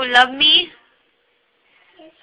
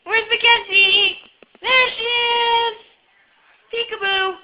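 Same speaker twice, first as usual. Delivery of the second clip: playful peekaboo; 8 semitones higher than usual. Real, not acted. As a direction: coo it up high like a game of peekaboo. Playing peekaboo with the child.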